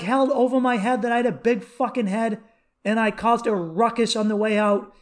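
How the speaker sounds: normal talking voice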